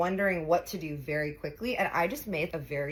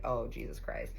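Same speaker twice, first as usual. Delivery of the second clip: under breath; 6 semitones lower, 5 dB quieter than usual. Retold or imitated.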